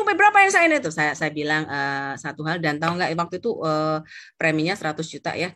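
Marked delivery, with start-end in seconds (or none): 0.0-0.9 high, loud demanding question; agitated client. Acted. Client demanding an answer from the agent, agitated. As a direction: burst out with a raised, high voice. Client's outburst acted in story.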